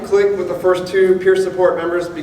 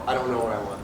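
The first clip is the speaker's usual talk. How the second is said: lost and defeated; 6 semitones lower, 8 dB quieter, faster than usual. Retold or imitated. Retelling his numb confusion to wife.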